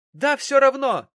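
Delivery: very high, very loud outburst; shrieked defiance; loud cry. A narrator's reading. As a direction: scream it in despairing anger. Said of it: "от её громкого крика".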